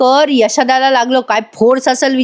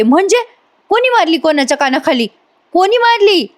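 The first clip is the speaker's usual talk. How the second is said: alarmed demand; 6 semitones higher than usual. Acted. Nami demands who hit whom.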